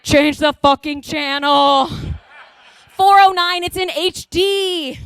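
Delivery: high, drawn-out holler; mock exasperated mom yell; impatient mom outburst. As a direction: holler across the living room, stretching the last words. Mom bursts out for channel change.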